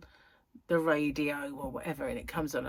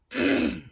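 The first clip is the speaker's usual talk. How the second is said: throat clearing; 7 semitones higher, 6 dB louder than usual. Real, not acted.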